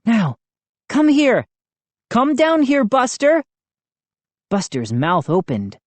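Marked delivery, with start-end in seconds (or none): 0.0-3.4 high, insistent calling; crying out. A narrator's reading. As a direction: call the dog down eagerly. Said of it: "I cried".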